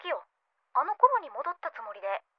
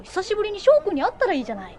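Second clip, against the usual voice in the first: quick, higher and louder push to go see him; eager urging; 6 semitones higher, 6 dB louder, faster than usual. Acted. Friend eagerly urging Maki to meet her old love, quick and loud. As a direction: nudge her friend eagerly, faster and brighter.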